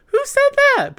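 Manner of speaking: mimicked very high, loud demand; Portia's outcry. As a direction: shriek the question like a reality star. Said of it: "And Portia goes".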